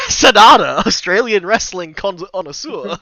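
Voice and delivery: hammed-up Australian ring-announcer call, laughing; a podcaster introducing Sanada's new gimmick in a hammy Australian accent; mock Aussie announcer. Mock Australian announcer call. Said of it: "That's his new gimmick".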